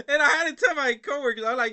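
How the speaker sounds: talking through loud, high laughter; words breaking into laughter; laughing. Real, not acted. Still laughing while telling viewers about telling his coworkers.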